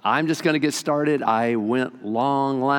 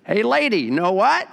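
parrot voice; 7 semitones higher than usual. Acted. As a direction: call out to her like a cheeky parrot. Parrot taunting the lady.

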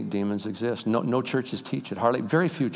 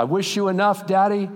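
daughter's farewell; 10 semitones higher, 5 dB louder than usual. Retold or imitated. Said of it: "She said".

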